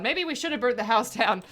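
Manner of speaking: ordinary talk, nothing marked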